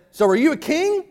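high, pointed question as Pilate; skeptical Pilate. Retold or imitated. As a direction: ask it like a doubting governor. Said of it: "Pilate said".

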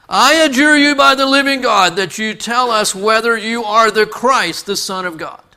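loud, high, commanding oath; high priest's solemn demand. Acted. High priest putting Jesus under oath, loud commanding demand. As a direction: thunder it out, easing at the end. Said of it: "And the high priest said to him".

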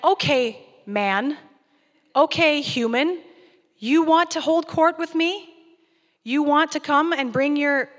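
a challenging God holds court, loud and high; God challenges Job loudly from on high; booming God. Retold or imitated. God answers Job, voiced loud.

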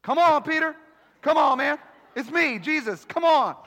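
high, loud, wheedling devil voice; the devil playing Jesus, calling loud and high; tempting devil. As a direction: coax Peter like a sly devil pretending to be Jesus. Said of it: "what would the devil say?".

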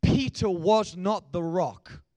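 Peter was not the rock, each word slow and heavy; slow emphatic denial. Acted. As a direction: lay down each word slowly.

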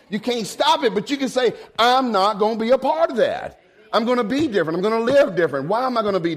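a preacher urging grown folks to be different; preaching at full force. Acted. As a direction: proclaim it like a vow. Sermon climax.

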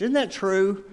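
normal talking voice

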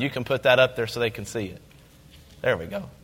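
ordinary talk, nothing marked

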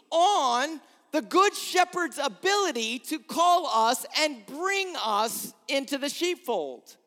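impassioned preacher's "Why would Jesus remove"; rising emphasis. Real, not acted. Repeats the question, building intensity.

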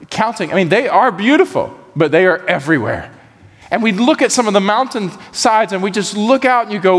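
ordinary talk, nothing marked

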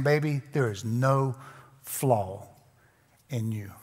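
soft and low, trailing almost to nothing; tender husband. Acted. Husband telling his bride she has no flaw, tender and hushed. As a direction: lay it down gently, like a whispered vow.

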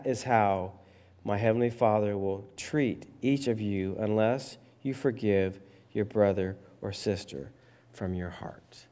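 ordinary talk

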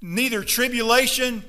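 loud, fiery preaching to the congregation; full-force preaching. Acted. Pastor urging his congregation not to whine, fiery loud preaching. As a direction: pound the message home from the pulpit.

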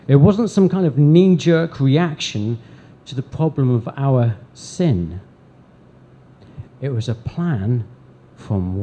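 loud, driving sermon climax; preaching at full force. Acted.

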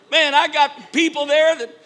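loud, rising indignant sermon; preaching at full force. Acted. Pastor to congregation, shouting in mock outrage.

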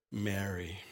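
a soft, low, hushed name; tender call. Retold or imitated. Risen Jesus speaks to weeping Mary, softly. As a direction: say her name gently, like a secret.

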